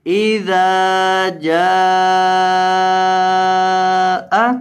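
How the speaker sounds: इज़ा जाअ with the जीम vowel drawn out long and the हमज़ा short; long jeem. Shown as a demonstration. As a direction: chant इज़ा जाअ slowly, holding the जा for three or four counts. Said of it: "हमजा को लंबा नहीं करना".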